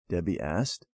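usual speaking voice

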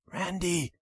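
soft, hushed call of her brother's name; trembling whisper. Acted. Little sister waking her brother at night, trembling whisper. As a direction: whisper his name, scared and shaky. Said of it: "she whispered in a trembling voice".

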